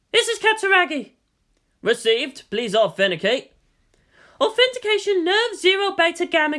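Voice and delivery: Misato's voice. A narrator's reading.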